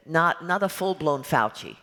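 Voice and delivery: imitating a mild New York accent for the matchmaker, not a full-blown one; light New York accent. Actress showing the director her matchmaker's New York accent, lightly imitated. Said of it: "give her a little bit of a New York accent".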